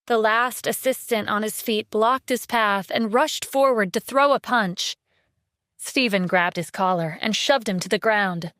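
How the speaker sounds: ordinary delivery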